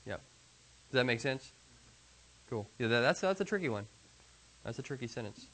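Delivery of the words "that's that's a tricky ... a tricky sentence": ordinary talk, nothing marked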